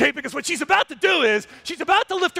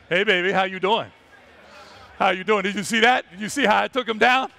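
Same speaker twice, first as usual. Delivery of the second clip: lower, smug come-on to the female; smooth male lion; 4 semitones lower than usual. Retold or imitated. Male lion boasting to the female, lower and smug. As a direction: play the cocky lion bragging to his lady. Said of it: "what's the first thing he does?".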